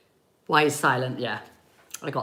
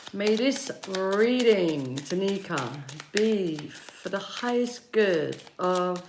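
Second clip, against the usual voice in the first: blessing; 6 dB quieter than usual. Acted. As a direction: bless the reading softly, unhurried. Blessing before reading.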